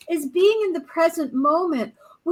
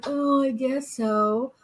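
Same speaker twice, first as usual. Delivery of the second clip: reluctant mumble; 3 dB quieter, slower than usual. Retold or imitated. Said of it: "or is like".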